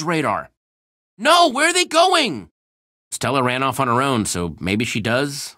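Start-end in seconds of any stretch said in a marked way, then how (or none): 1.2-2.5 alarmed shout. Acted. Friends leaving, alarm.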